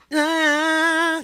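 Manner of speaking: high la, air held back. Shown as a demonstration. Coach showing breath held back, high la. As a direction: sing la while forcing the air back. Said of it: "forcing yourself to hold the air back".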